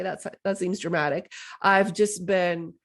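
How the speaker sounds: ordinary talk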